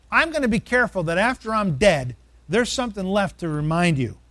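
normal talking voice

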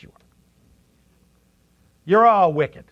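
normal talking voice

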